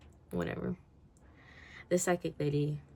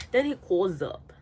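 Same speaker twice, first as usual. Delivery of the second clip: ordinary talk, nothing marked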